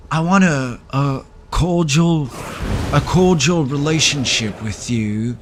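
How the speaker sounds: lightly mocking imitation of an ex; a hint of a put-on boyfriend voice; mocked ex voice. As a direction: stretch out his proposal in mild mimicry. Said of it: "That's how he speak in my mind".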